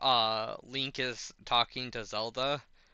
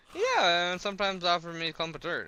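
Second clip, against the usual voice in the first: higher, fast and offhand; casual Link; 7 semitones higher, 5 dB louder than usual. Retold or imitated. Retelling Link explaining the dirt, high and offhand. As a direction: shrug it off cheerfully. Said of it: "She's like".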